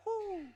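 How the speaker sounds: high-pitched "whoo"; admiring whoop. Acted. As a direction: echo a friend's admiring whoop. Buddy agrees, admiring the car.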